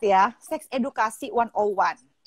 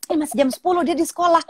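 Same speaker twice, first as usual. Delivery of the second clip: retold mother's panic, pitched up; mock struggle to keep your voice down in pitch; high-pitched alarm; 5 semitones higher than usual. Panicking mother questions daughter phoning from school, high-pitched retold alarm. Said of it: "udah susah banget untuk nggak high pitch".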